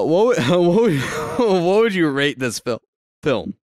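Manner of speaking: hiccuping mid-question; hiccups. Real, not acted. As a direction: talk through hiccups. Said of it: "Sorry, I got the hiccups there".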